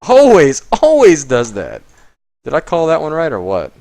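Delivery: loud, high told-you-so shout; triumphant loud I-knew-it cry; excited shout. Real, not acted. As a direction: yell it like you called it. Called the bad turn card.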